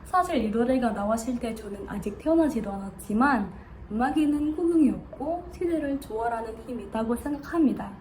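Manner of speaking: ordinary delivery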